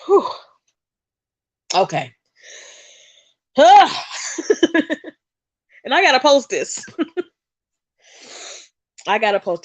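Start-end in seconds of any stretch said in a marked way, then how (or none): 3.6-5.1 emotional sigh. Real, not acted. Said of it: "I'm emotional".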